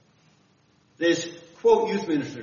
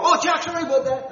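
higher, bright mock apology; apologetic teammate; 11 semitones higher, 4 dB louder, faster than usual. Teammate on the bench apologizes to the preacher for cursing, mock sheepish. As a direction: toss off a sheepish sorry, pitched up. Hockey guys apologize for swearing.

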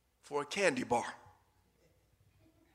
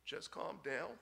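put-on soft, calm hush to a child; father quietly calming his screaming son; 7 dB quieter than usual. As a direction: quietly soothe the fussing boy. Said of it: "the father was quietly saying".

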